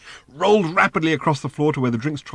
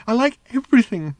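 moaned; 12 semitones higher, 4 dB louder than usual. Acted. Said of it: "moaned the robot".

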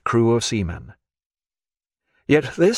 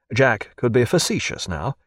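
plain reading voice

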